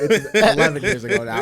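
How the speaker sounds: laughing through the words. Real, not acted. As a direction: tease a friend while cracking up. Laughs while teasing about old games.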